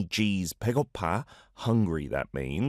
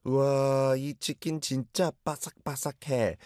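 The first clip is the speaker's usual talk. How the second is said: echoing the learner; 6 semitones higher, much faster than usual. Retold or imitated. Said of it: "Lita's clip".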